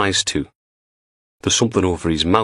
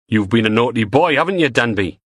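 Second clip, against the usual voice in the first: a lightly voiced Geordie thug, mocking; a thug taunting the tied-up superintendent, in a light Newcastle accent; 4 semitones higher than usual. Teasing Geordie menace. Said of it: "A man's voice, Newcastle accent".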